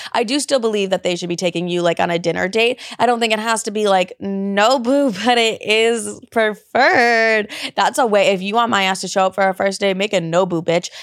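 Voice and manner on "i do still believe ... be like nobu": normal talking voice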